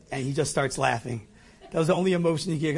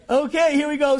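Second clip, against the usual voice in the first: laughing resignation; 11 semitones higher, 6 dB louder than usual. Retold or imitated. Resigned exclamation.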